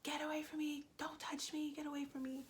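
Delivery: high, frantic scream for her life; retold panicked screaming. Screaming high at the knife-wielding attacker in terror. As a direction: scream at him to get away, terrified. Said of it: "I started screaming".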